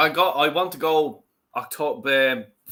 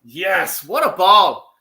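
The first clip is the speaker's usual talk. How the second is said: goal celebration; 3 semitones higher, 9 dB louder, slower than usual. Real, not acted.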